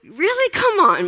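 high, incredulous Really, then a pleading come on; exasperated disbelief. Acted. Jesus scolding Peter for the sword, exasperated. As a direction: roll your eyes at your friend in disbelief. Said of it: "And Jesus is like".

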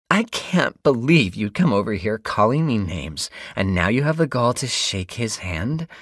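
indignant whine. A narrator's reading. Angry blonde protests to the neighbor.

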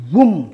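loud short wum of the blade strike; mock impact sound. Imitating the blade hitting her leg, loud. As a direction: punch out the sound of the cut.

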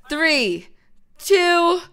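loud, drawn-out start countdown. Real, not acted. Player counting down to start the challenge, loud and slow. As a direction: count them in loudly, stretching each number.

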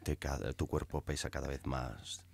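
low, slow, soft hypnotist drone. Retold or imitated. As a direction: lull them to sleep, slowly. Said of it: "Te ponías el disco y empezaba".

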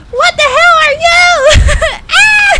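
high, loud shrieking through laughter; screaming laugh. Real, not acted. Player facing a giant enemy, laugh-screaming. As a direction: scream and laugh at once in horror.

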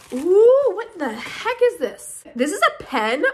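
loud high ooh then slow question; intrigued by the mystery item; curious ooh. Real, not acted. Discovering odd item.

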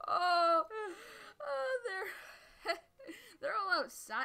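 high, loud laughing with a crying edge; panicked laughing. Real, not acted. Enemies appear, panic laughing.

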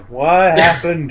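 mock bewildered. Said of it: "people just come to going".

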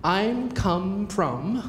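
very slow, careful "I'm come from"; quoting the error. Retold or imitated. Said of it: "我听到了".

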